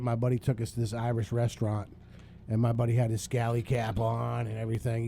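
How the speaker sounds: ordinary delivery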